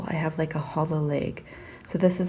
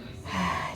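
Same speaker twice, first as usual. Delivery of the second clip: weary sigh; 8 dB quieter than usual. Real, not acted. After cheese chant.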